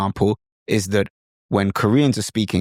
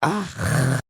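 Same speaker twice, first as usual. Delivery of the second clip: Korean hesitation "ah"; 4 semitones higher than usual. Shown as a demonstration. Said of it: "make sounds like this".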